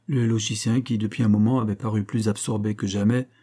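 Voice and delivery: ordinary delivery, nothing marked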